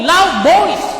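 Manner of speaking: preacher stressing loud voice. Shown as a demonstration. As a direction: ring out loud voice. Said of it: "Ito yung nangyari ho dito".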